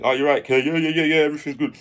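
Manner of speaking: high, loud, hurried reassurance; over-eager put-on composure; covering up tears. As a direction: act as if nothing is wrong. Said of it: "I'll be hiding it".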